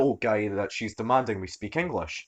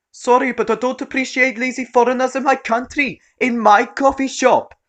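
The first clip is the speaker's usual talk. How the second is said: indignant shrill rant; 10 dB louder than usual. Acted. Entitled mum appealing to customers.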